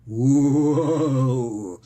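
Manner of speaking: drawn-out spooky "whoa"; long, loud ghostly whoa; mock spooky wail. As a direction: play-act a demon taking hold. Mock demon possession noise.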